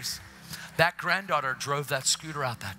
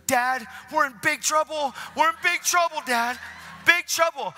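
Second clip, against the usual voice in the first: high, loud frantic warning to Dad; acted child panic; 13 semitones higher, 6 dB louder than usual. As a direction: play a daughter sounding the alarm. Daughter hides, warns dad in panic.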